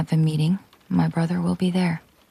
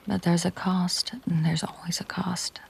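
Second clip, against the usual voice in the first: whispered warning, dropping ever softer; 6 dB quieter than usual. Acted. Protector whispering the cost of his protection, hushed whisper. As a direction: breathe the warning so it barely carries.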